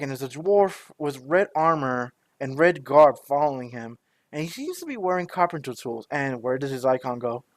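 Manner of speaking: ordinary delivery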